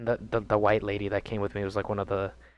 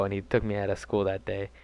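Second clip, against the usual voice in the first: ordinary delivery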